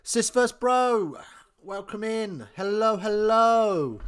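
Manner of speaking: loud, high, drawn-out greeting to the chat; booming streamer hello; excited welcome. Real, not acted. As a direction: throw a big welcome out to the viewers. Greeting viewers joining the stream.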